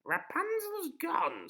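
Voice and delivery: very high, loud, slow crone; a gloating witch, pitched high and slow; sinister witch. Acted. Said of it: "said the witch with a sinister laugh".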